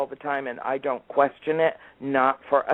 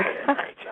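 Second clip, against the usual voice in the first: real laugh. Real, not acted. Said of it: "I just started to laugh".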